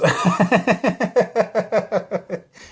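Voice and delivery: loud, high burst of laughter. Real, not acted. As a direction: laugh at the absurdity. Laughing at his own story.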